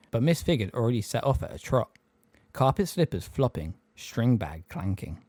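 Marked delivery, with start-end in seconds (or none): none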